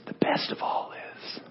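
faint breathy dying man's whisper. Acted. Dying preacher whispers to friends leaning in, faint whisper. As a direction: whisper the last words barely out. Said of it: "he starts whispering".

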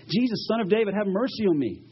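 high, pleading call; crying out. Retold or imitated. Blind man calls to Jesus for mercy, crying out. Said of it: "He cries out".

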